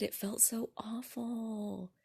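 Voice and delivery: hushed, unvoiced and slow; whisper. Real, not acted. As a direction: drop to a breathy secret whisper. Whispered confession of awful feeling.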